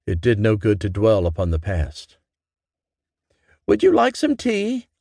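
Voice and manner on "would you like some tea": high motherly voice calling upstairs; mother calling her daughter down for tea. A narrator's reading. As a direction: call up the stairs, lilting and bright. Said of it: "Mama called from downstairs".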